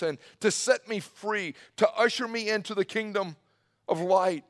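high, loud preacher's cry; impassioned plea. Acted. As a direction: cry out the question with rising fervor. Preacher voices an impassioned question to God.

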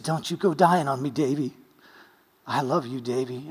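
soft, low tender urging; tender plea. Retold or imitated. Wife at the hospital bed, softly begging him to live. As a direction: plead gently and lovingly.

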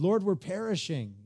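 high, panicked cry to the Lord; mock the disciples' panic; panicking plea. Disciples in the storm crying out, panicked. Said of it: "the disciples were panicking".

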